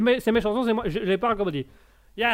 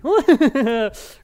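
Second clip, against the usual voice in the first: laugh; 7 semitones higher, 5 dB louder than usual. Real, not acted. Laughs at his own remark.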